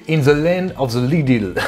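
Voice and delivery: slow, clumsy French-accented English title; self-mocking terrible English accent; bad English accent. Shown as a demonstration. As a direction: stumble through the English title in a poor English accent. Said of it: "Mon accent anglais est terrible".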